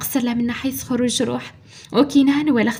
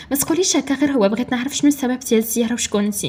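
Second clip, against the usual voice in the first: ordinary delivery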